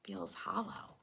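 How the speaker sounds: hushed whisper; a whispered hollow feeling. A narrator's reading. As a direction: breathe the words out softly. Hungry belly in story.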